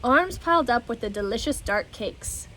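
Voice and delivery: ordinary delivery, nothing marked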